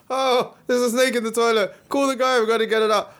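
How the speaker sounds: high casual voice calling for help; mock Australian local. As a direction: play the unbothered local who finds a snake normal. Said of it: "and it's just like".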